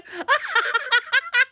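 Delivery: high burst of real laughter. Real, not acted. Laughing at show scene.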